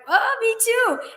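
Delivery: drawn-out high me too; high slow me too. Retold or imitated. Christina answering the caller's compliment, high and slow. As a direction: stretch it high and slow, pleasantly caught off guard. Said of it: "And I'm like".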